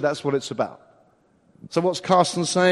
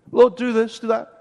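mock bossy prayer; 5 semitones higher, 6 dB louder than usual. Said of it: "we get him out of our pocket".

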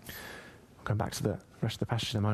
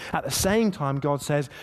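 ordinary delivery

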